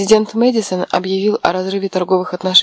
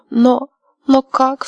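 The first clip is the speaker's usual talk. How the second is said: slow, high sobbing question; 7 semitones higher, 5 dB louder, much slower than usual. A narrator's reading. Аманда спрашивает сестру сквозь слёзы: всхлипывая. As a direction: choke the words out through tears. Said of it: "всхлипывала та".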